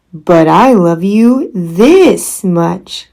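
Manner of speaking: big, slow, loud fatherly hare; slow loud papa hare. Acted. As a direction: outdo him slowly and grandly. Big Nut Brown Hare answers.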